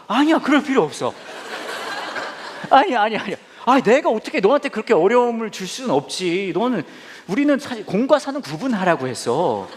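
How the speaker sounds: hammed-up fussy excuses; mock refusal. Pastor refusing his staffer's ride offer, mock flustered.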